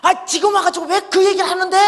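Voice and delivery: high, loud indignant outburst; angry protest. Retold or imitated. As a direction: imitate a friend yelling back in outrage. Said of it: "막 이러고 화를 내는 거예요".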